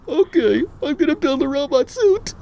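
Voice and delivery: high, tearful mock whimper; whiny Shredder voice. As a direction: squeak it like a beaten villain. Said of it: "He's like".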